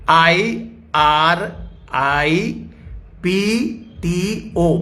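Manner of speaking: आई आर आई पी टी ओ spelled letter by letter, very slowly; slow spelling. Shown as a demonstration. Preacher dictating spelling to the congregation, very slow. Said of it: "मैं धीरे-धीरे बोलूंगा".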